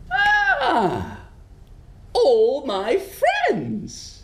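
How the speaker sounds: high, very slow delighted witch. Acted. Witch greeting creatures in box, high and slow. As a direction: breathe it out slowly in wonder. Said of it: "She says".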